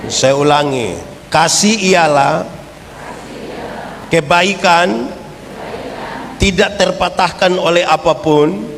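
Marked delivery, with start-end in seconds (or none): 1.3-8.7 slow, measured creed for repeating; recitation. Acted. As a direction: say it slowly for the church to follow.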